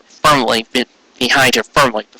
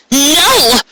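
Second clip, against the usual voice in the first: sharp denial; 14 semitones higher than usual. Acted. Trixie cutting off Raindrops, high sharp denial. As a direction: slam the door on the accusation. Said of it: "Trixie interrupted".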